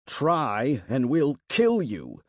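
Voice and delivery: ordinary delivery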